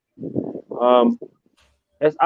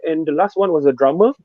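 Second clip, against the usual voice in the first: ordinary delivery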